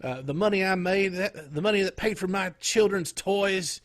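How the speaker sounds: bad acting in a North Carolina accent; mock North Carolina accent. Retelling a wrestling promo, mock North Carolina accent. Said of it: "he has a really bad North Carolina accent".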